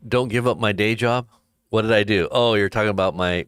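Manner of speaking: ordinary delivery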